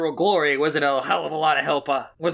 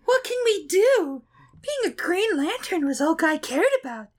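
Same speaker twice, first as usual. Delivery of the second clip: man doing a woman's voice; 14 semitones higher than usual. Acted. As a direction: squeak it like a ditzy bimbo. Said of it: "I'll do the girl voice for her".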